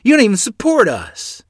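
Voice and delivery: high, loud accusations hurled at him; a son yelling at his father in the road; furious yell. Acted. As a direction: let it all out at the man. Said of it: "I yelled".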